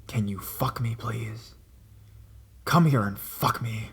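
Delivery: slow, breathy whispered creep; creepy whisper. Retold or imitated. Caller whispering to the operator Mel, slow creepy whisper. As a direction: whisper it slowly into the phone. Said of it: "almost like a whisper".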